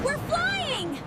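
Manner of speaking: ordinary talk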